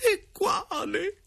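very high, slow falsetto lament; hammed-up tearful, high-pitched plea; pleading directress. A narrator's reading. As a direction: wail it in a drawn-out falsetto. Directress questions uncle's ghost.

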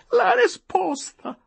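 full outburst. A narrator's reading. Tearful wife demands the answer, shrieking it out. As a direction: scream the demand the second time. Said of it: "proruppe subito donna Adelaide".

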